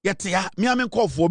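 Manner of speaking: ordinary talk, nothing marked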